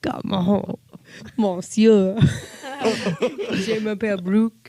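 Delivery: giggly mock French accent, flirting. Wife flirting with husband for the game, giggly mock French accent. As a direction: ham up a French accent to seduce him, laughing. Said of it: "in your best French accent".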